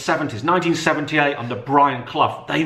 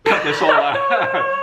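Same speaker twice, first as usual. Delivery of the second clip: laughing; much slower than usual. Real, not acted. Cracking up over his own accent.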